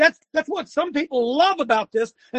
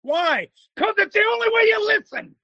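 yelling; 7 semitones higher than usual. Real, not acted. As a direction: yell it proudly. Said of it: "damn right I yell".

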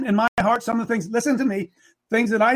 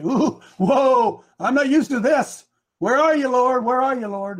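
higher, mildly panicked cry to the Lord; mock alarm; 6 semitones higher, 4 dB louder, slower than usual. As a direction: act out a believer suddenly out of their depth. Acting out a panicked believer.

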